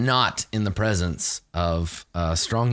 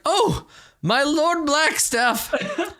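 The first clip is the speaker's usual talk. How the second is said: much higher, slow, theatrical noble voice; mock courtly greeting. GM's NPC greets Blackstar with hammed-up courtesy. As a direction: ham up the fawning lord. GM voicing Thavius mockingly.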